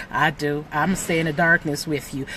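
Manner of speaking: a hint of a low clinging fear; voicing fear. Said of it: "Just like".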